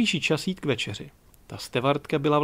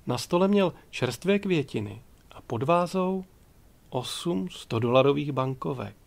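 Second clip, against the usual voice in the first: plain reading voice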